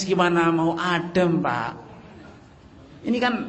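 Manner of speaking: ordinary delivery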